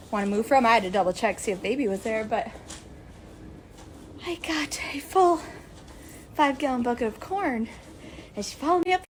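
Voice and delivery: ordinary delivery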